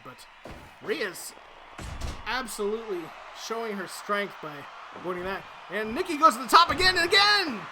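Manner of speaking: high, loud excited wrestling commentary; live play-by-play. Acted. Commentator calling the match for the viewers, excited play-by-play. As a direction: call every move like a ringside announcer.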